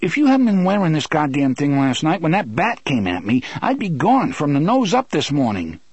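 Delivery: high, insistent character voice; heated Eddie. Acted. Eddie protesting that Roland needed his gun, heated.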